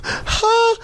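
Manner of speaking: very high gasp of surprise; starstruck gasp. Retold or imitated. Seeing a celebrity walk past, high gasp. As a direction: gasp as a star appears. Seeing another star arrive.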